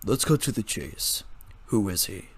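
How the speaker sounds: low tired lecturer; stern low voice. A narrator's reading.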